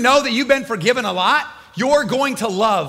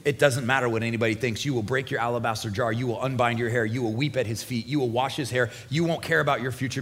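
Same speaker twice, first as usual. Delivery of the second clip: low, soft and intimate sermon voice; hushed preaching; 9 semitones lower, 6 dB quieter than usual. Acted. Preacher softly speaking to the congregation, hushed. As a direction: draw the listeners in close, softly.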